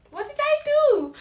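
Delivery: high, loud cry of disbelief; stunned outburst. Retold or imitated. Rejected student cries out in disbelief, high and loud. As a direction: wail it like you can't believe it. Upset at being rejected, retold.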